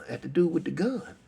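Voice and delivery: ordinary delivery, nothing marked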